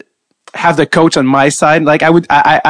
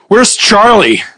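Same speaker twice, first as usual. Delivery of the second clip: lightly imitated coach, pitched up; mock authority; 6 semitones higher, 3 dB louder, slower than usual. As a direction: demand it like a coach taking charge. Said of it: "the way he came in".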